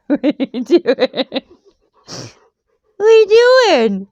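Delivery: put-on high baby-talk question; playful high question. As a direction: tease the dog. Teasing pet, high.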